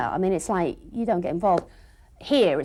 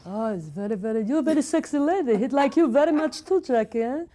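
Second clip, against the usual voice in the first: flirty character voice; 4 semitones higher than usual. Acted. Character flirting with host Jackie, higher put-on voice. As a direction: flatter her in a character voice.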